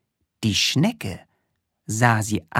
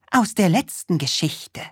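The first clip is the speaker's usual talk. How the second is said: Krümel voice; 7 semitones higher than usual. Acted.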